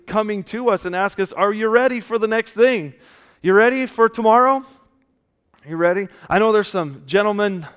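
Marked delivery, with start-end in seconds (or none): 1.3-6.1 higher, louder pointed questions; rousing challenge. Acted. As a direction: put the question squarely to the crowd. Readiness personified, challenging the congregation.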